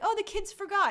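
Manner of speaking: high, airy dismissive quote; lightly mocking shrug; mock breezy brush-off. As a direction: toss it off like it's no big deal. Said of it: "They're like".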